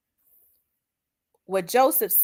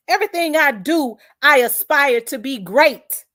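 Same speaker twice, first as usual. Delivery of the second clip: defiant declaration; 6 semitones higher, 5 dB louder than usual. Real, not acted.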